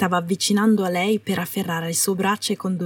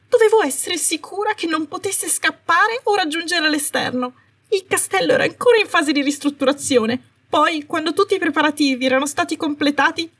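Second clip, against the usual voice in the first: much higher, pleading and defensive; flustered Umbridge; 8 semitones higher than usual. Acted. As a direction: justify yourself in a high nervous rush. Umbridge pleads her excuses.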